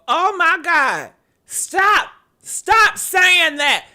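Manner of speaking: loud, high, slow stop saying that; hammed-up shocked protest; mock outrage. To commenters, loud hammed-up outraged stop.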